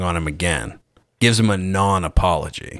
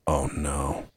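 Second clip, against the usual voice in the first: hushed, sinking "oh no" in a much softer voice; soft, retold self-reproach; quiet dismay; 6 semitones lower, 10 dB quieter than usual. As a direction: murmur it to yourself as the regret hits. Retold dismay backstage after interview.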